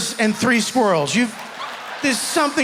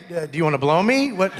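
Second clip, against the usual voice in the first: roommate; 7 semitones lower, faster than usual. Acted. Guy roommate's crude reply.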